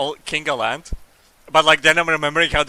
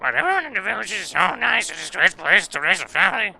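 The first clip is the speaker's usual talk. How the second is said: high-pitched villager, easygoing pace; villager character voice; 7 semitones higher than usual. Acted. Villager welcoming a newcomer to the village, high-pitched character voice. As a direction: voice a chirpy game villager, pitched up.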